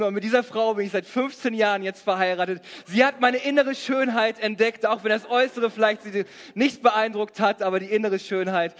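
ordinary talk, nothing marked

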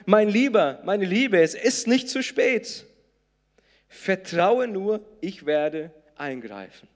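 fervent comforting promise; encouraging voice of Jesus. Acted. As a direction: reassure the listener it is not too late. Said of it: "Diese zweite Stimme sagt".

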